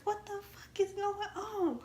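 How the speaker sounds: high mock yelling mama; mother scolding in a high voice. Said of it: "He like, she like".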